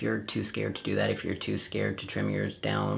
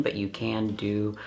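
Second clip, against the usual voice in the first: ordinary delivery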